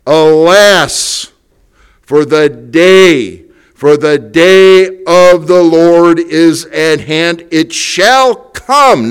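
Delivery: slow, loud, weighty reading of the prophecy; solemn scripture proclamation. Acted. Pastor reads Joel's warning of the day of the Lord to the congregation, slow loud proclamation. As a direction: toll each word like a warning bell.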